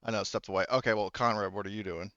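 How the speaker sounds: usual speaking voice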